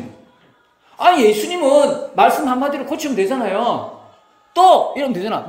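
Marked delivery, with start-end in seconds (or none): 4.5-4.9 명령하듯 높고 크게 외치는 "떠!"; a sharp high command to open the eyes; commanding shout. Retold or imitated. 예수님 명령 흉내. (높고 큰 명령조 외침)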